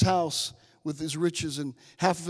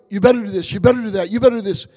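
loud, bossy sing-song nagging; harsh preachy "you better" chant; mock stern demand; 3 semitones higher, 10 dB louder, much faster than usual. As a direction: bark it like a strict taskmaster. Mimicking his old legalistic demands.

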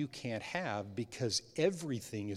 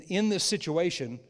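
usual speaking voice

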